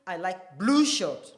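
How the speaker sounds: blue said loudest in the sentence; stress on blue. Shown as a demonstration. Teacher showing the class, stress on blue. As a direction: punch the word blue. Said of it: "not green shirt".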